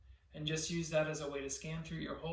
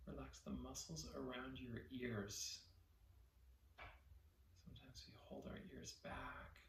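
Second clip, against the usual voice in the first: guided meditation; 3 semitones lower, 13 dB quieter than usual. Real, not acted. Teacher leading listeners to relax their body, soft guided meditation. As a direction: softly guide them to let go, phrase by phrase.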